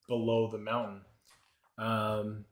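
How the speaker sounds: ordinary talk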